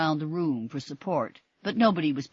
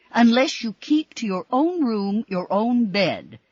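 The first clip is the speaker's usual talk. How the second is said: angrily; 8 semitones higher, 9 dB louder than usual. A narrator's reading. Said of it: "said Missus Mitchell angrily".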